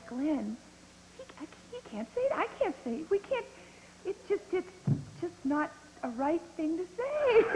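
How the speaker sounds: high, fast, giggling protest; laughing plea. Retold or imitated. Actress begging the director, high giggling protest. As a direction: plead through the giggles. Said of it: "the two of us would fall apart".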